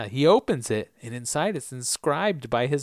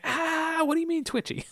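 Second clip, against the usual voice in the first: much higher, offended squeaky question; nervous mock Faraday; 4 dB quieter than usual. Faraday objecting to the nickname, high and squeaky. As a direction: squeak it out, put off.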